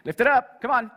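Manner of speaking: rally them to lift it up; preacher's rallying command; urging call. Real, not acted. Urging congregation to raise Bibles.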